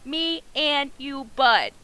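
"Me and you, bud" slow and loud, word by word; slow emphatic repeat. Real, not acted. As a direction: draw out "Me and you", land hard on "bud".

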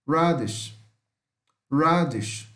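radish with an English R and sh ending; correct English radish. Shown as a demonstration. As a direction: repeat radish clearly, English R, stress on the first syllable. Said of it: "Não é radish, não".

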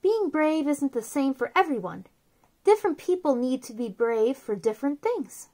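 soft, gentle read-aloud of a picture book; storybook reading. Acted. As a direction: read it softly to little kids, like a bedtime book.

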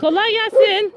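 high, loud greeting across the distance; calling out. Real, not acted. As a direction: call out a friendly hello to someone far off. Greeting a neighbour from afar. (uzaktaki komşuya yüksek sesle selam)

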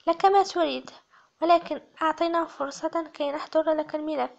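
plain reading voice

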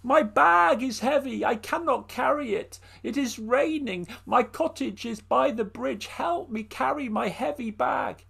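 put-on high, pleading old woman's voice; a frail old woman begging; old woman in the rain asks for help, high and pleading.

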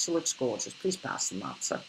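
put-on quiet British accent. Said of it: "the British accent".

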